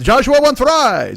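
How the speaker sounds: high, loud, drawn-out proclamation; Joshua. Retold or imitated. Deli owner announcing his bread choice, high and emphatic. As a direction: declare your own choice slowly and loudly as the old deli man. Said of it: "but what would Joshua want".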